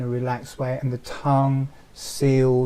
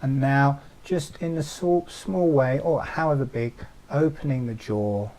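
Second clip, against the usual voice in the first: ordinary talk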